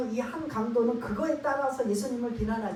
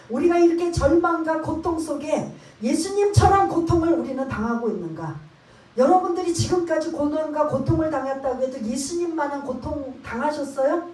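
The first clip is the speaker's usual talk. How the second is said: fervent preaching; 4 semitones higher, 8 dB louder than usual. Real, not acted. Preaching at full force.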